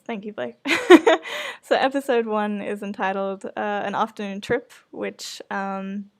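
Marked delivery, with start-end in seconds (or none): none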